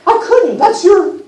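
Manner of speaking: a satyr too awed to accept the gift; flustered satyr Grover. Acted. Grover overwhelmed by the lyre.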